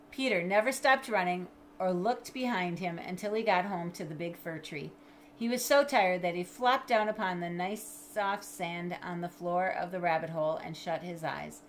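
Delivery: ordinary delivery, nothing marked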